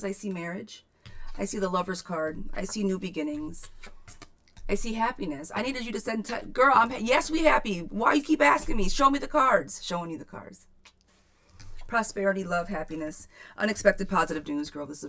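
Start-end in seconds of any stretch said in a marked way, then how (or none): 5.5-9.8 loud, high, playfully indignant; hammed-up disbelief at a lukewarm answer; mock outrage. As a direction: pretend to be shocked she isn't thrilled.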